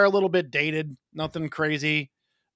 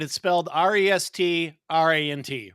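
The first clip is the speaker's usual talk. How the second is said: ordinary delivery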